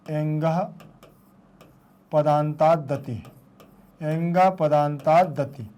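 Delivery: slow, deliberate chant of पदांतादति; slow sutra recitation. Shown as a demonstration. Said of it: "teacher recites sutra". (सूत्र को धीरे-धीरे पाठ करते हुए)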